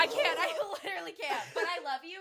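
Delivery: soft, high voice, laughing through tears; tearful. Real, not acted. Too moved to continue praising friend, soft tearful laugh. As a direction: back off into a small tearful squeak. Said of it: "I'm gonna cry".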